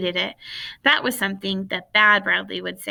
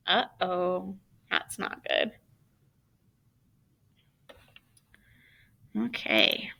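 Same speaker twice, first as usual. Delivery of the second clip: soft whispered comment to herself; whispered aside; 7 dB quieter, slower than usual. Real, not acted. Reader muttering to herself off the story, whispered. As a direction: drop out of the story and mutter softly. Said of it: "reader's aside, off the story".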